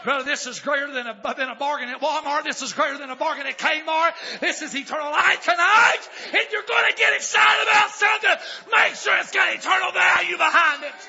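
rising pulpit fervor; building preacher's shout. Real, not acted. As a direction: whip the congregation up.

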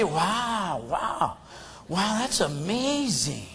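slow, soft mock wonder; understated, put-on awe; feigned enthusiasm. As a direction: pretend to be amazed, quiet and drawn out. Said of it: "enthused and excited to hear about it".

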